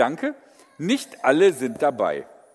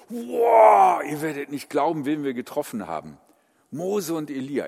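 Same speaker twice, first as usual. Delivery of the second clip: big shouted wow, then hushed gossip; excited bragging; 4 dB louder than usual. Retold or imitated. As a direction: brag to the others, starting with a shout. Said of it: "Und die".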